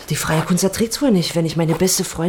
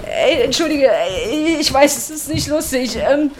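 giggly apology; 7 semitones higher than usual. Acted. Apologising after laughing at him.

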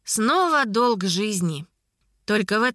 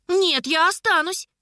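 put-on high-pitched, insistent girl; stubborn, pitched way up; defiant refusal; 8 semitones higher than usual. A narrator's reading. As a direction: dig in and refuse. Niece refuses to go home.

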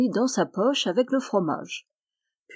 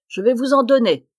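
loud threat, pitch up; angry shout; 3 semitones higher, 6 dB louder than usual. Acted. Tailor striking the flies, loud angry threat. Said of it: "s'écria-t-il".

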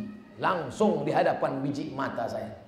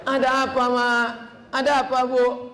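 higher, louder call of concern; louder greeting; 6 semitones higher, 4 dB louder than usual. Retold or imitated. Preacher asking the weeping mother what happened, louder. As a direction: ask again, pitched up and louder.

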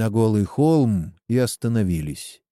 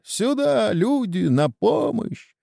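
high-pitched call for help; cry for help; 12 semitones higher, slower than usual. A narrator's reading. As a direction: summon people urgently, voice right up. Battle cries for help. (крик о помощи)

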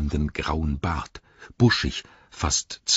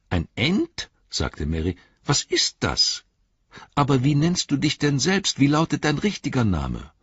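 higher, quick questioning hobbit voice; eager hobbit; 7 semitones higher than usual. Acted. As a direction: press the giant for his real name. Merry questions the Ent. (Merry fragt neugierig mit heller Stimme)

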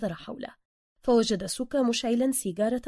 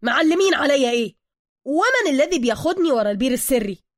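loud, raised-pitch indignation; angry; 4 semitones higher, 10 dB louder than usual. A narrator's reading. Bruce stung by Suka's version, angry and loud. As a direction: demand an answer in outrage. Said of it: "بروس بغضب".

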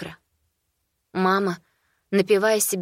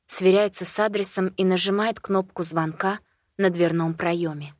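plain reading voice

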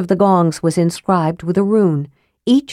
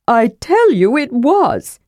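slow, higher, firm insistence; insisting; 6 semitones higher than usual. Acted. Kender to doubting dwarf, slow firm insistence. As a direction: dig in and insist. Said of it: "Tass insisted".

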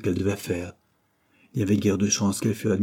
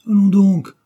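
loud, high outburst of disbelief; scoffing exclamation; 11 semitones higher, 12 dB louder than usual. A narrator's reading. Dare to Stubbs on hearing the refusal, scoffing exclamation. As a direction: burst out, dismissing the news.

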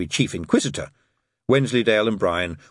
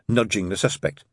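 ordinary delivery

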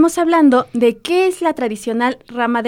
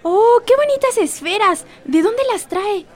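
delighted customer; 8 semitones higher than usual. Acted. Customer admires ornaments.

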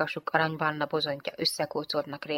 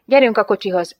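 loud, raised-pitch order; bossy command; 4 semitones higher, 9 dB louder than usual. A narrator's reading. Jane directing the lieutenant to the car, commanding. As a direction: order them along, loudly. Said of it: "dirigálta".

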